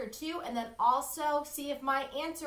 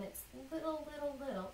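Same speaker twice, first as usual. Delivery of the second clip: much softer, light little voice; small soft voice; 3 semitones higher, 8 dB quieter, faster than usual. Shown as a demonstration. Teacher showing a short soft wave, softly. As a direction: shrink your voice with the words. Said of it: "a short line".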